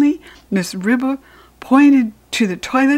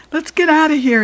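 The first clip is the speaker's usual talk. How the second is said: high urgent cry, raised and loud; cried out; 7 semitones higher, 4 dB louder than usual. A narrator's reading. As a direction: yell it to get everyone out. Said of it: "he cried".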